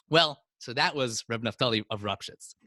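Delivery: usual speaking voice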